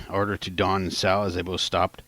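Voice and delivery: usual speaking voice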